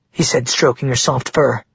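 plain reading voice

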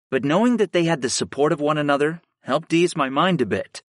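usual speaking voice

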